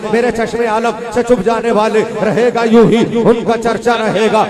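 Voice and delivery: rhythmic declaimed naat; fervent verse recitation. Real, not acted. Preacher reciting the Bareilly poet's praise verses, rhythmic declamation. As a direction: recite in measured, insistent half-lines.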